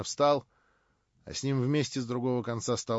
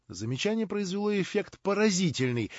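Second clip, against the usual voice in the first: ordinary delivery, nothing marked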